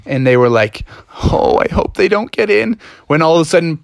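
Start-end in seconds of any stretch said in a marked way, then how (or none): 1.2-2.8 worried frogs. Acted. Frogs fear goblins outside.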